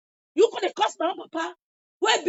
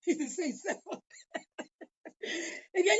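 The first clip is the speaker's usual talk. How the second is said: laughing through words; 8 dB quieter, slower than usual. Real, not acted. Laughing mid-rant.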